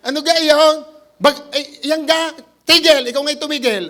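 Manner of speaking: loud, high Batangas grumble of a man just woken; Batangas accent. Retold or imitated. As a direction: tell the storm off like an annoyed Batangueño. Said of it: "sa atin sa Batangas". (punto Batangas, malakas na utos sa bagyo)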